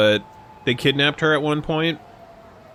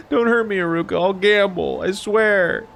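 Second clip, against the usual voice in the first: mock scared pleading; 8 semitones higher than usual. Pleading with Haruka, mock fear.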